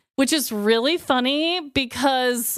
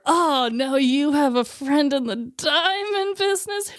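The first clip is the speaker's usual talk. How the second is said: mock ad voice; 4 semitones higher than usual. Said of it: "It's either Zales or".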